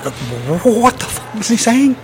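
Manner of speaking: high, slow, baffled disbelief; mock confusion at a thick accent, drawn out high. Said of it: "and I was like".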